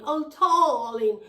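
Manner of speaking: loud, drawn-out posh Queen impression; exaggerated royal English drawl; mock Queen's English. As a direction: send up a posh English accent, high and slow. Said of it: "she talked like the Queen".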